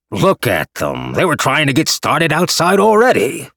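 loud bellowing shout. A narrator's reading. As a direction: bellow it across the room. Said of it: "Yao Che bellowed".

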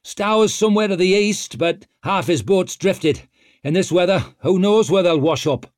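high, loud report; called out. A narrator's reading. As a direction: shout the news over the weather. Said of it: "called Jin".

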